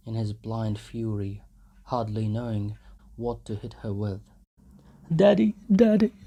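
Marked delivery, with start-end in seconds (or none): 5.1-6.1 put-on much higher small boy's cry; child crying out. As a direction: call to father in distress. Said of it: "he cried".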